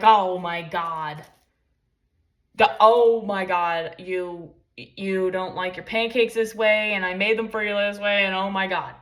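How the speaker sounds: loud, drawn-out hammed-up complaint; mock overreaction. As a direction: make a huge drama out of nothing. Mock overreaction to small things.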